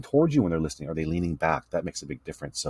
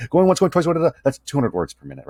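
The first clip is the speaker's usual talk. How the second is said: loud, high, rapid auctioneer patter; 8 semitones higher, 4 dB louder than usual. Retold or imitated. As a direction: rattle it off like an auctioneer. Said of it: "like an auctioneer would speak".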